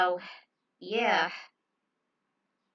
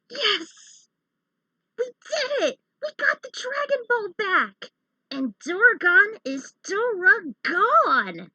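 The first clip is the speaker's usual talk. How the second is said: much higher, louder victory shout; triumphant cheering; 14 semitones higher, 9 dB louder, slower than usual. Acted. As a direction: cheer like you just won it all. Heroes cheer after winning fight.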